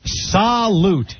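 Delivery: high, drawn-out catchphrase salute; Hee Haw salute. Retold or imitated. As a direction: sing it out like a country TV toast. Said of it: "as they used to say on Hee Haw".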